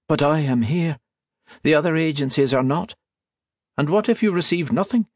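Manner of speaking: a lightly voiced anxious archivist holding his price; haggling priest. A narrator's reading.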